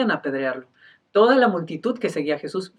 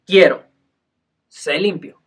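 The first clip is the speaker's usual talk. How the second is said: firm command; 7 dB louder than usual. Retold or imitated. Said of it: "es concisa, es precisa".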